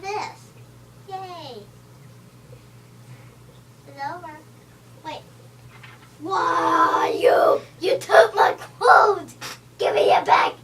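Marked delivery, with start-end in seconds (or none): 6.2-10.5 loud, slow demanding yell; playful furious yell, louder; angry yelling. Pretend. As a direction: shout at the thief to give it back. Character's clothes stolen.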